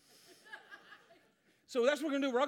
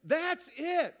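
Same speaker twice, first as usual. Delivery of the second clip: excited yes; 7 semitones higher, 7 dB louder, much slower than usual. Real, not acted. Reacting to trophy image.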